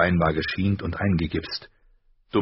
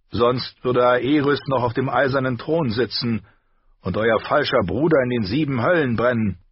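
higher, scornful knight's voice; 6 semitones higher, 4 dB louder than usual. A narrator's reading. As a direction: spit the words at Ned's brother. (höhnischer Ritter)

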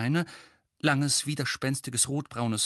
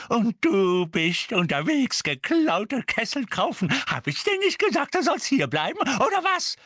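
high, loud telling-off; furious scolding; 11 semitones higher, 5 dB louder than usual. A narrator's reading. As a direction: scold hard, climbing in pitch. Mrs. Figg scolds Mundungus furiously.

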